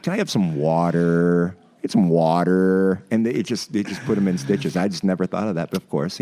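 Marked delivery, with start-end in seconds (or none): 0.0-3.0 slow, louder American water with a hard r; mock American accent. Turkish twins teasing Americans, mock American accent. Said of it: "They're like".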